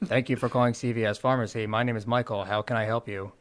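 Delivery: low, robotic radio announcer greeting. Shown as a demonstration. Pharmacy worker answers a call from corporate, robotic radio voice. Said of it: "put on, like, a radio voice to try and sound like a robot".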